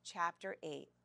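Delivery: ordinary delivery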